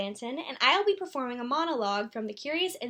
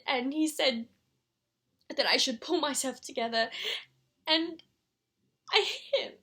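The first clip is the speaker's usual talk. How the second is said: acted upset confession; 3 semitones higher than usual. Mother admitting the row with Christopher's father, upset and halting. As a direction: struggle to get the confession out.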